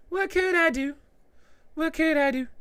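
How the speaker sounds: very high, monotone put-on falsetto; mock helpless squeal. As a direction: squeak the plea in a silly high voice. Mock repeats of viewer's plea.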